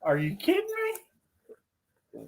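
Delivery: high, drawn-out disbelief; incredulous. Real, not acted. Guest objects to the Baby Huey comparison, incredulous. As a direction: stretch it out, incredulous.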